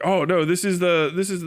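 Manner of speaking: ordinary talk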